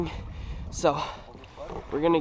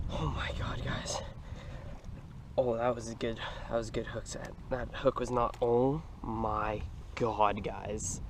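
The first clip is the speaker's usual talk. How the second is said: awed hush; 6 semitones lower, 9 dB quieter than usual. Real, not acted. Awed after the catch.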